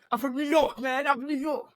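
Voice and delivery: high-pitched, badly faked New York accent; hammed-up attempt at a New Yorker.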